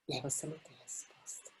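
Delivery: a pained whisper with no answer. Real, not acted. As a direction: let the words almost vanish. Moved silence after child's question.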